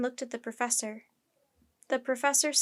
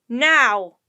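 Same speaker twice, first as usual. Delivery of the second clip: very loud one-word order; roaring; 14 dB louder than usual. A narrator's reading. Captain insists on being obeyed at once, roaring. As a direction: bellow it as a command. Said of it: "the captain roared again".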